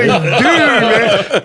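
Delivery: high stoner whine with laughter; mock tearful. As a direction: imitate a stoner welling up. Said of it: "Tears are coming".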